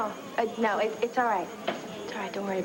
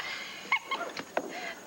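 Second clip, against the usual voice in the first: high breathy laugh; 8 dB quieter than usual. Real, not acted. Laughs at bad accent.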